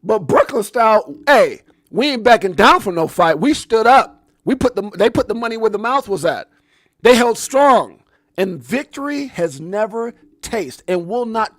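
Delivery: loud, high rallying cry; fired-up shout. Real, not acted. Host cheering on Ripple's fight, fired-up shouting. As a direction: shout it with full fighting pride. Fired-up reaction to played victory statement.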